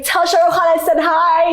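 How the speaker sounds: high, playful mock squeal; excited friend imitation. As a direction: call it out like a giddy friend.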